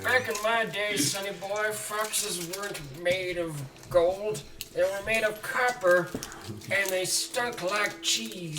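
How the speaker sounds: put-on high, slow creaky old man. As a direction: grumble like a cranky old-timer. GM voices old man Tim.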